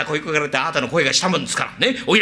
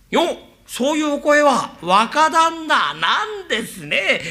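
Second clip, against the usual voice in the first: high, slow, chuckling welcome; laughing greeting; 6 semitones higher, slower than usual. Acted. Zen laughing as he welcomes the young master.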